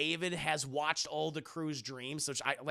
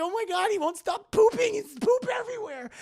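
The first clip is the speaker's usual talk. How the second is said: very high, loud panicked squeal; cartoonishly panicked onlooker; mock horrified shriek; 8 dB louder than usual. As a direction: squeal it out in hammed-up disgust. Acted horrified reaction to pooping.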